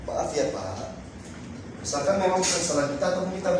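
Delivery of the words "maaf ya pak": polite apology. Shown as a demonstration. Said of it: "tetap ucapkan ini".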